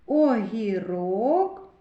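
Огірок stretched out slowly, each syllable drawn apart; drawn-out Огірок for a phonics lesson; slow syllable-by-syllable reading. Shown as a demonstration. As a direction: sound out Огірок slowly for children to hear every sound. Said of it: "Р твердий приголосний".

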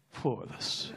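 breathy whispered swear; whispered curse. Retold or imitated. Nervous whisper before walking over. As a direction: steel yourself under the breath. Resigned before approaching girl.

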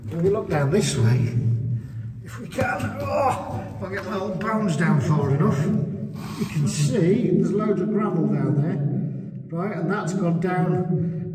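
slow, echoing as if inside a cave. Real, not acted. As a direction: voice bouncing off a hollow chamber. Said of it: "You sound like you're in a cave".

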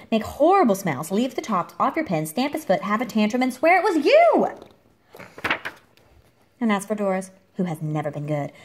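ordinary delivery

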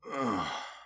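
soft high sigh of relief; relieved sigh. Acted. Dragon resting after the arrow is gone, relieved sigh. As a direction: breathe out the pain.

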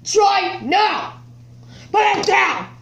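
sharp loud yell; shouting. Real, not acted. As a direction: yell to stop him. Stopping brother popping balloon.

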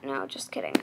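quick, soft whispered aside. Real, not acted. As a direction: whisper the take-back. Taking back a brag.

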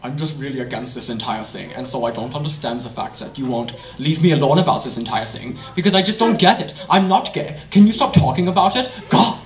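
deep, increasingly agitated mock German; Germany impression. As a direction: start deep and controlled, end fed up. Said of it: "do you wanna hear my Germany impression?".

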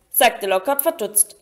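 ordinary delivery

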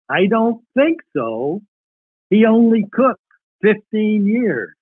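reading a wartime letter aloud, lifted. Acted. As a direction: read his letter to the audience like a cherished keepsake.

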